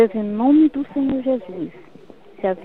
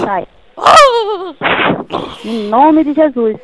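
forceful commands with long pauses; exorcism prayer; 5 dB louder than usual. Real, not acted. Woman commanding evil out of a sick boy, forceful exorcism prayer. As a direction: cast out evil with insistent, emphatic bursts.

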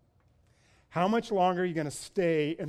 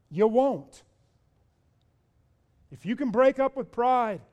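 loud, high, driving preacher's climax; preaching at full force; 7 semitones higher, 7 dB louder than usual. Acted. Preacher urging the congregation to break with pride, loud and rising. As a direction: build it up and hammer each if.

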